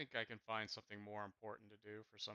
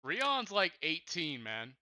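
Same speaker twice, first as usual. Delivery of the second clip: sudden, much higher and louder objection; loud protest; 5 semitones higher, 10 dB louder, much slower than usual. Real, not acted. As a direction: push back hard on the others. Protesting his character's age.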